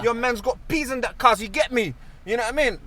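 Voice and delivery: London slang, hammed up; cocky London street voice; london roadman accent.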